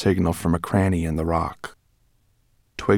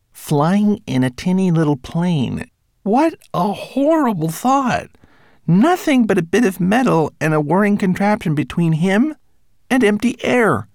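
fearful thought; 11 semitones higher than usual. A narrator's reading. Twigleg's fearful inner thoughts.

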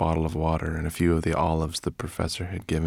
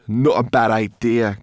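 loud, raised, growly retort; growled; 6 semitones higher, 9 dB louder than usual. A narrator's reading. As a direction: growl it back as a grumpy brownie. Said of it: "growled Sorrel".

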